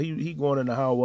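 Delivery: ordinary delivery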